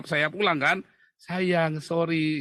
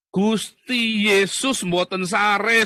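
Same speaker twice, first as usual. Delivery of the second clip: loud, slow, raised declaration; ringing proclamation; 7 semitones higher, 7 dB louder, much slower than usual. Real, not acted. Preaching to viewers that God is awake, loud proclamation. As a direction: proclaim it like a preacher, drawing out each word.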